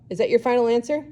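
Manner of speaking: usual speaking voice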